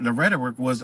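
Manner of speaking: ordinary delivery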